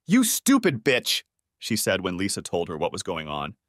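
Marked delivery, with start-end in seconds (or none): 0.1-1.2 slow, high, spat out in rage; a daughter curses her cheating mother, slow and furious; furious insult. Acted. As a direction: drag each word out in contempt. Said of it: "Renee was far more upset".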